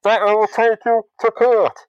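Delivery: high, loud, slow outraged squawk; indignant villain. Retold or imitated. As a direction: protest your stolen trick like a petty showman. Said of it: "Mysterio turns around and like".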